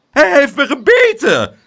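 high, loud yelp of outrage; outraged cry. A narrator's reading. Bitten mother accusing the child, high loud cry. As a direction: shriek the accusation in alarm. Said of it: "riep ze".

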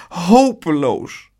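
slow, drawn-out tearful lament; sobbed despair. A narrator's reading. Aunt despairing of Spijtebijt to Pluk, slow sobbed lament. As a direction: wail it slowly in despair. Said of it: "snikte ze".

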